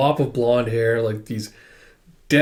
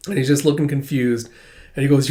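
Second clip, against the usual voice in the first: normal talking voice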